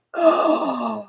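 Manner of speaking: big shocked intake of breath; theatrical gasp of horror; horrified gasp. A narrator's reading. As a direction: gasp loudly at the sight. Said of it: "I did gasp at that sight".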